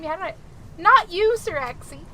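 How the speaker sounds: ordinary talk, nothing marked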